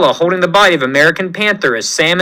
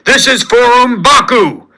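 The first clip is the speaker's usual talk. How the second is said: battle scream; 5 semitones higher than usual. Acted. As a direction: roar it as the final blow lands. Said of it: "as T'Challa screams".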